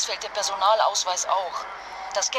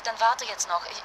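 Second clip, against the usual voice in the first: ordinary delivery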